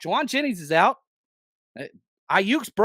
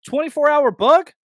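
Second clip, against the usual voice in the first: higher, louder, rising on "bug"; incredulous; 8 semitones higher, 6 dB louder than usual. Real, not acted. Host scoffing at the listener's excuse, incredulous and loud. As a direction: throw "Twenty-four hour bug?" back in disbelief, pitch jumping up on "bug". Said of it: "So maybe it's a twenty-four hour bug".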